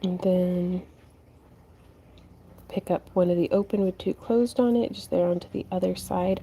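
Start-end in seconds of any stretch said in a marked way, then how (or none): none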